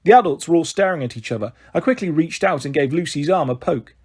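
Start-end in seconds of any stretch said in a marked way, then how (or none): none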